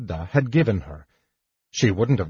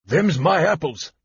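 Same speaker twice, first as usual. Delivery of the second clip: higher, lightly voiced rustic fruit vendor; accusing vendor; 6 semitones higher than usual. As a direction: point the finger, a touch aggrieved. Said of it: "accused the fruit vendor".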